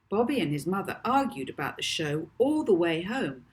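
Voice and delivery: ordinary delivery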